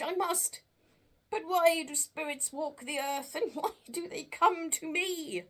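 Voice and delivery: high, loud, anxious questioning; a scared old miser pleading for answers; frightened Scrooge. Acted. Scrooge frightened, asking the ghost why spirits walk.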